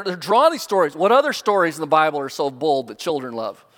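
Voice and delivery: ordinary talk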